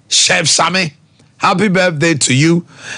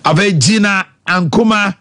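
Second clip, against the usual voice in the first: ordinary talk, nothing marked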